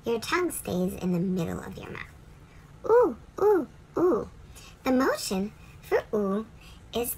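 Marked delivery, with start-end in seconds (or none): 2.9-4.3 ooh pushed forward, high and loud; ooh sound. Shown as a demonstration. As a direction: lips forward, voice out. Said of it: "you push your voice forward and out".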